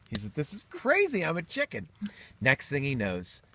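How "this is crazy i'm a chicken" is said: high-pitched mock astonishment; acted disbelief. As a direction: voice leaps up, freaked out by being a chicken. Man discovers he is a chicken.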